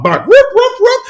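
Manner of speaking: high mock dog yips; dog barking. Vlogger playing a person who wants to be a dog, yipping high. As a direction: bark it out like a playful puppy.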